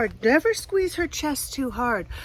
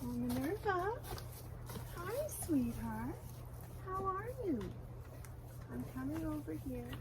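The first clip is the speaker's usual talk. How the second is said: much softer, coaxing a hen; 4 semitones higher, 17 dB quieter than usual. Real, not acted. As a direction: gently coax the chicken toward you. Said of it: "talk softly to her".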